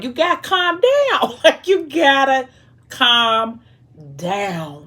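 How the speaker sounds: high, drawn-out amused plea to calm down; exasperated scolding. Real, not acted. Reader telling the wife to calm down, exasperated and amused. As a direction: stretch each word out in mock exasperation.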